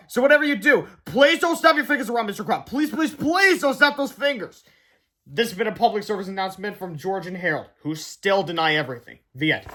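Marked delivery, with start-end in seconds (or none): 0.1-4.6 put-on pleading kid voice. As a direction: beg them please, please. Kids' pleading warning.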